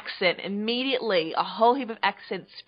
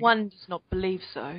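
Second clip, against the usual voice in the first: lightly put-on upper crust British accent; playful mock royal British accent; Queen Elizabeth voice. Host answering in a mock upper crust British accent. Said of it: "as though you were Queen Elizabeth".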